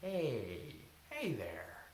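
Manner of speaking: tender, quiet comfort; soft voice. Shown as a demonstration. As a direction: greet yourself like a scared child. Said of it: "the soft voice".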